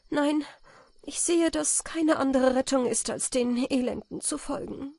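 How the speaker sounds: lightly voiced lady, resigned; a hint of a brave noblewoman; countess voice. A narrator's reading.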